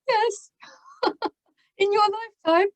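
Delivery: high, laughing comeback; a giggling, sky-high yes to a doubtful elder; laughing retort. Retold or imitated. Cheerful retort to elderly relative.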